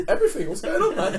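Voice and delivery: squeaky, laughing self-imitation; high breaking voice. Driver answering the aggressive stranger, voice high and breaking. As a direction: replay your own nervous high squeak. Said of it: "it's a breaky voice, like, high".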